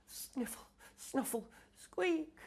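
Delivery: very high, soft sniffling; sniffly squeak. Acted. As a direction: sniffle and squeak like a small creature. Crying noises imitated.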